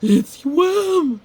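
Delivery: high, loud panicked cry; terrified cry. Acted. Wolf crying out at the monster, high, loud and terrified. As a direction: scream it in terror. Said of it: "cried Wolf".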